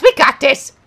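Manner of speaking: rally the viewers; hyped self-encouragement; loud. Real, not acted.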